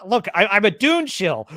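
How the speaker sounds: ordinary talk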